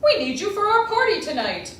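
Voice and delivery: loud high calling; called out. Acted. The rabbi calling out to the runaway latkes, loud. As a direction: shout after them from afar. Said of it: "called out".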